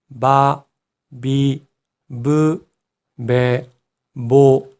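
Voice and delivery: 마, 미, 무, 매, 모 as ba, bi, bu, be, bo; voiced b row. Shown as a demonstration. Teacher demonstrates the changed row, voiced b in 마, 미, 무, 매, 모. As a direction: separate syllables on a voiced b. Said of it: "히읗이 비읍으로".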